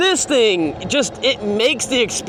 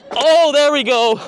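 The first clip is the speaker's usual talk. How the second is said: excited; 8 semitones higher, 4 dB louder than usual. Real, not acted. Landing the jump well.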